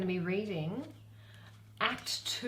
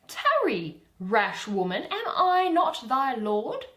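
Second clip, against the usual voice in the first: Oberon; 6 semitones higher, 9 dB louder, slower than usual. Acted. Reading Oberon's lines.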